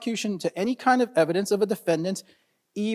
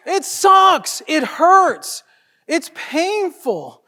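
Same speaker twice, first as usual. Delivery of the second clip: high, drawn-out lament; hammed-up anguish; 5 semitones higher, 5 dB louder, much slower than usual. Lawyer commiserating with colleagues over painful evidence, hammed-up anguish. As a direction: wail it slightly, stretching the last words. Hamming up how much this hurts.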